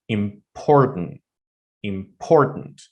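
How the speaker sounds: "important" with POR drawn out and punched; stress on POR. Shown as a demonstration. Teacher showing students word stress, middle syllable stressed. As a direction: lean hard on the middle of "important", im-POR-tant. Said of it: "I'm putting extra syllable".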